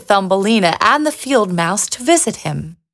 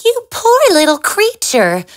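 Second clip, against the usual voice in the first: high little mouse voice; a field mouse pitying the lost girl, high and small; kind field mouse; 10 semitones higher than usual. A narrator's reading. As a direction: squeak it out with pity, like a tiny kind mouse. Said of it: "said the field mouse".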